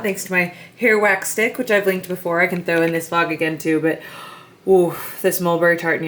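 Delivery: normal talking voice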